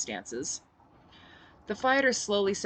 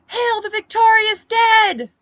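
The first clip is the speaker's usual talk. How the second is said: very high, loud, slow cry; shouted; 15 dB louder, slower than usual. Acted. Phoenix shouts to the cheering crowd. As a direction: howl it to the rafters. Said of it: "Phoenix shouted".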